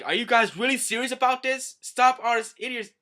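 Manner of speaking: high, loud acted outrage; hammed-up exasperated yelling; indignant shout. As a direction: yell at them to stop this madness. Hachi bursts in to object.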